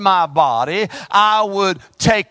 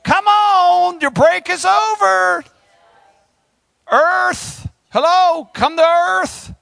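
wake-up call; 7 semitones higher, slower than usual. Acted. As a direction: shout them back to work. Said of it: "ring the bell and say".

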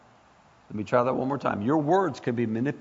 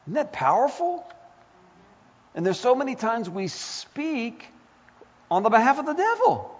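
high, impassioned preaching voice; rising sermon intensity; fervent preaching; 11 semitones higher than usual. Acted. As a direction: preach it up with fire.

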